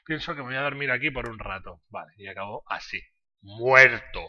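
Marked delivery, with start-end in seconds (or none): 3.4-4.2 sudden loud cry of defeat; loud shout. Real, not acted. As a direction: blurt it out loudly. Character dies in game.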